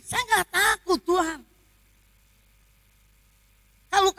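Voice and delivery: high-pitched, forceful declaration to God; impassioned vow. Retold or imitated. Believer telling God she will die serving, fervent high declaration.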